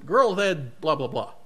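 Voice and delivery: ordinary delivery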